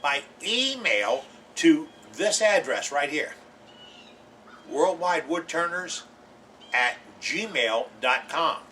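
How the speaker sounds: very slow, each word spaced out; real slow. Shown as a demonstration. Host dictating the email address to viewers, very slowly. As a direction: dictate the address so nobody misses it. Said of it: "I gotta say it real slow".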